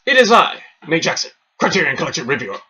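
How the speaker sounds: big high shouted show intro; over-the-top movie presenter, loud punched words; hammed-up announcer. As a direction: bellow a mock-grand introduction, peaking on the title.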